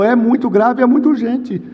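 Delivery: high, pressing phone voice; acted high-pitched urgency; urgent insistence. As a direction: press home how serious it is. Pastor presses urgency in retold call.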